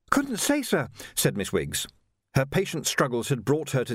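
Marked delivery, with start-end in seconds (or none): 0.1-0.9 put-on much higher, polite young Jocasta, a touch breathless; young woman voiced. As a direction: play a well-mannered girl treading water. Jocasta answers.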